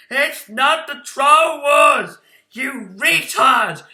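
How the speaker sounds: slow, high, furious shout; mock viewer scream. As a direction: scream it like an angry fan correcting him. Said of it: "before you people scream".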